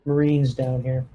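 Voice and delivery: normal talking voice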